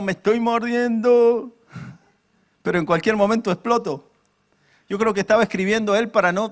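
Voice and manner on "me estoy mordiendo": high, slow, held-back groan of words; pent-up complaint. Acted. Preacher voices Job biting himself back, pent-up. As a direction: draw it out high, barely containing it. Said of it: "Como diciendo".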